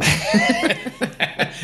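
amused laugh. Real, not acted. Joke about not being a maiden.

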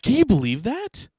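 hammed-up outraged lady; mock indignant mom. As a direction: squeal it like a gossiping mom. Mom presses her point again.

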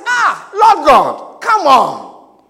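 loud, slow, drawn-out protest to God. Retold or imitated. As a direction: cry out Ah, Lord God in open protest. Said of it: "He says".

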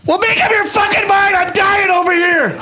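laughing shout. Real, not acted. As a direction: shout while cracking up. Yelling at rival to hurry.

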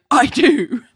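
high, loud mock declaration; emphatic declaration. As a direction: burst in declaring it. Randy bursting into office.

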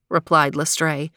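ordinary delivery, nothing marked

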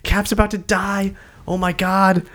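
high, frantic retold panic; a mock freak-out, voice way up; panicked disbelief. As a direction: squeal it like the fans are all doomed. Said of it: "everybody keeps saying".

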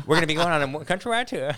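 high, exaggerated protest voice; hammed-up protest, pitched high; mock protesting royals. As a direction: announce it like an indignant pampered royal. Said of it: "like, protesting".

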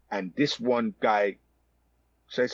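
normal talking voice

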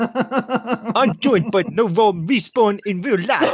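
slow, put-on American accent, hammed up; mock American accent. Host tries a fake American accent on his co-hosts, slow and hammy. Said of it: "should I do my American accent?".

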